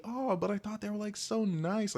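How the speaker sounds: high, airy mock of a clueless reply; mocking naive voice. Imitating a clueless response to abuse, mocking. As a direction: parody the oblivious answer. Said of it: "your first response shouldn't be".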